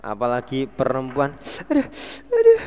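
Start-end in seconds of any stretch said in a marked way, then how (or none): 1.7-2.6 very high, loud crying wail; hammed-up sobbing yell of aduh; mock cry of pain. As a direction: overplay a woman howling in pain. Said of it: "Gayae kelaran ngoten lho".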